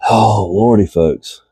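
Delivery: normal talking voice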